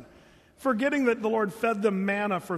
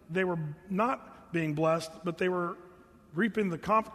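ordinary talk

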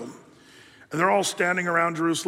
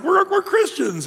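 much higher, slow mock squeal; 13 semitones higher, 5 dB louder than usual. Strongmen tell Peter they are Christians in a mock high voice. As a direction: squeak it out playfully.

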